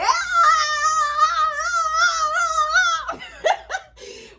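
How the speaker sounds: long shrieking laugh; high cackling laugh. Real, not acted. Laughing hard at the memory of the pool party, shrieking.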